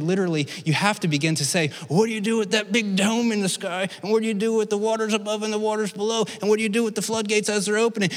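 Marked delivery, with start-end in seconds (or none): 1.9-8.1 high, hurried string of challenges; pressing, sped-up singsong queries; rapid-fire questioning. Acted. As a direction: rattle off the awkward questions on one high note. Rattled-off questions to literalists.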